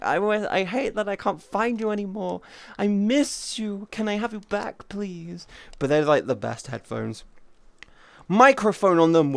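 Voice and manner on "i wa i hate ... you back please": mock pleading. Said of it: "and I'm like".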